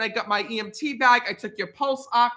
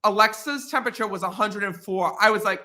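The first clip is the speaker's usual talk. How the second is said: ordinary talk, nothing marked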